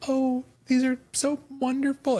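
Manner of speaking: high, slow, sugary fake gratitude; mock a crunchy mom's forced delight. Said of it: "And she's like".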